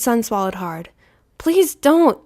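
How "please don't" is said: ordinary delivery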